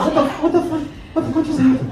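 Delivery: high-pitched startled disbelief; retold shock. Comedian retelling the slap to the crowd, stunned. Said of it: "I'm like".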